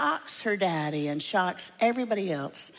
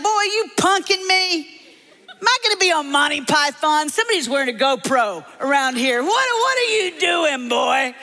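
loud, high, incredulous holler; mocking Tennessee father; 9 semitones higher, 7 dB louder than usual. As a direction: jeer at the boy in disbelief. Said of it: "he just bursts out laughing".

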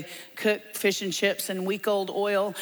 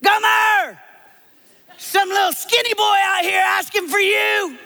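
full-volume, high-pitched holler; yelling for daughter; 13 semitones higher, 9 dB louder than usual. Acted. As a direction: holler through the house to summon her. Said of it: "starts yelling for Gomer".

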